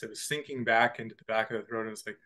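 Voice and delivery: ordinary delivery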